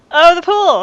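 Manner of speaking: loud sudden realization; excited realization. Real, not acted. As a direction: blurt it out excitedly. Realizes the location.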